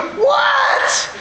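very high, panicked mock yelp; imitating an alarmed mother, hammed-up shriek; anxious. Anxious mother answering her son's call, mock panicked. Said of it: "we knew she was anxious".

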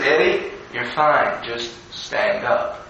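low, slow and soft, unbothered; calm reassurance. Retold or imitated. Older boys to little Eddie in the water, calmly reassuring. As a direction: talk him down quietly.

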